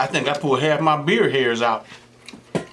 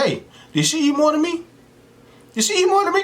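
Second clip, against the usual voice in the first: much higher, squeaky mock voice; mocking Zach; 14 semitones higher than usual. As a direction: play him worried he's losing the race. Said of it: "he started looking at her and said".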